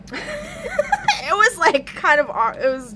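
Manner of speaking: words breaking up into laughter; laughing through it, high and tight. Real, not acted. Recounting a weird dream to viewers, laughing it out.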